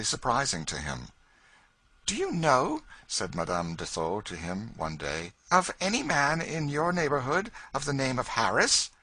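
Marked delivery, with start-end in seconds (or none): 2.1-2.8 high, eager lady's voice; a curious lady probing gently; French lady voice. Acted. Lady asking George about Kentucky, high eager voice. 5.5-8.9 high, eager lady's voice; a curious lady probing gently; French lady voice. Acted. Lady asking George about Kentucky, high eager voice.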